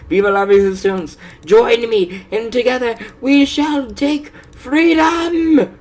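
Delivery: high, loud revolutionary shout; battle cry. Acted. Mock rebel leader rousing followers, shouting high. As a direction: rouse the troops to revolt.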